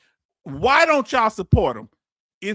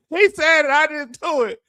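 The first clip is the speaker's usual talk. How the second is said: higher and louder, still laughing; laughing denial; 8 semitones higher, 4 dB louder than usual. Real, not acted. Host laughs while disowning a viewer's joke to the chat, giggling. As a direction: toss off the blame mid-laugh.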